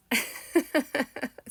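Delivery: high, loud giggle; real giggle that tails off; happy laugh. Real, not acted. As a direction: laugh out in delight. Laughs with joy at new friend.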